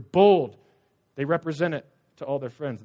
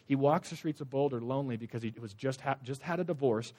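ordinary talk

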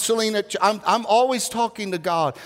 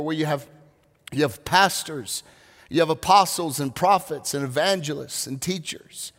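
usual speaking voice

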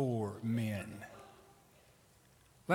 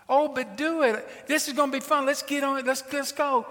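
high, insistent coaxing mother; pressing mom; 11 semitones higher than usual. Acted. Mother pressing scared daughter toward the ride, insistent. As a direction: push the child along, pitched way up. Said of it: "the more her mother pressed".

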